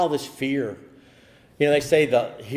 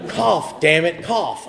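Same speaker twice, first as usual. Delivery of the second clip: higher and louder, an impatient repeated command; insistent order; 8 semitones higher, 7 dB louder, much slower than usual. Retold or imitated. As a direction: bark it out like a fed-up doctor. Voice insists he cough.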